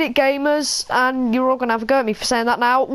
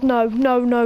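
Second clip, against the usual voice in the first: usual speaking voice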